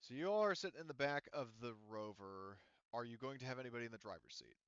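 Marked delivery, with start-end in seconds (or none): none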